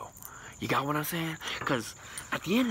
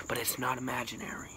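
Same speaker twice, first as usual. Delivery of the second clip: whisper; 2 semitones lower, 5 dB quieter than usual. Acted. Conspiratorial aside.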